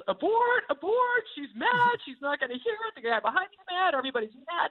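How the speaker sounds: high, frantic inner alarm; panicked. Retold or imitated. Speaker panicking to God at the checkout, frantic high alarm. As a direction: hit the panic button, high and fast. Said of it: "my first response is".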